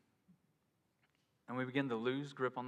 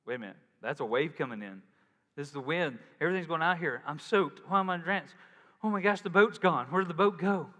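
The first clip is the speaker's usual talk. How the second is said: building mock panic; 5 dB louder than usual. Preacher voicing Peter, panic rising to loud. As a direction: let the panic climb to a shout. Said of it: "he's going".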